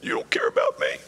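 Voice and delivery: low, whispered whimper of a self-pitying believer; mock a sulking child praying; whining pitiful prayer, breathed almost without voice; wimpy whimpery prayer. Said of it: "we get wimpy and whimpery".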